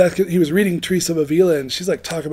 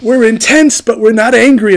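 imitating loud, intense insistence; mock-heated denial, high and loud; raised voice; 4 semitones higher, 9 dB louder than usual. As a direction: protest loudly as the man being imitated. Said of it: "he's fucking raising his voice".

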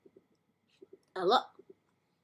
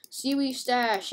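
ordinary talk

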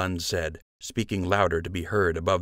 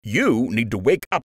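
higher, louder stern lecture in a gruff voice; angry officer; 5 semitones higher, 3 dB louder than usual. A narrator's reading. Lieutenant berating Joe, angry lecture. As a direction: dress him down hard.

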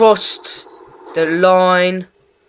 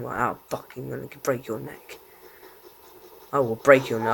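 ordinary delivery

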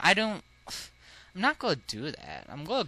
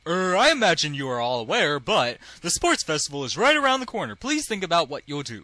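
lightly voiced teacher making an announcement; a hint of a teacher character. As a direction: address the class, a touch stagey.

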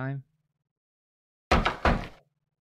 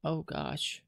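softer, breath taken away; shaken hush; 8 dB quieter than usual. Acted. Child shaken after the monster leaves, hushed. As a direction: barely get it out.

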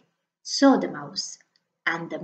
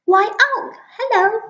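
much higher mouse, bright and quick; little mouse voice; 13 semitones higher, 6 dB louder than usual. Acted. The mouse announcing Snake, high little voice. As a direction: pitch it up like a tiny mouse.

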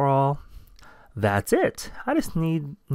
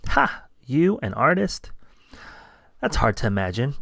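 ordinary delivery

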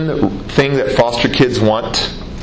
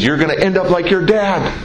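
retold angry telling-off in a raised pitch; imitated yelling mother; 9 semitones higher than usual. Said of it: "she keeps yelling at me".

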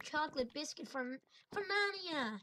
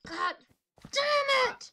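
much higher furious shout; angry yell; 12 semitones higher, 9 dB louder, much slower than usual. Real, not acted. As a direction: rage out loud. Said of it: "I have anger issues".

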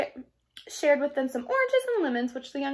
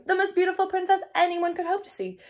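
gushing; 7 semitones higher, 5 dB louder than usual. Acted. Sister gushing.

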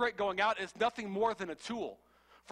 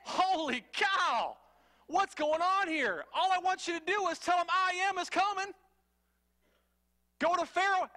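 higher, louder, slowed disbelief; exasperated God; 9 semitones higher, 4 dB louder than usual. Retold or imitated. God exasperated with Moses's endless excuses. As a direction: throw up your hands at Moses. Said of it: "And God's like".

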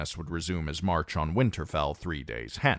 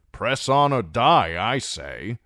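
a hearty knight, louder, rallying the table; blunt knight; 6 semitones higher, 6 dB louder than usual. A narrator's reading. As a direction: bang the table for marching. Clifton's blunt battle cry.

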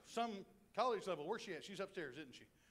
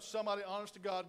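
ordinary delivery

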